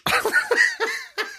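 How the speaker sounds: very high shrieking laugh; high squealing laugh at a caller's story; shriek of laughter. Real, not acted.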